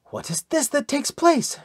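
high, rising bewildered question; bewildered, pitched well up; bewildered exclamation. A narrator's reading. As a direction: exclaim it in baffled disbelief. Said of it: "she exclaimed bewilderedly".

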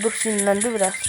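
normal talking voice

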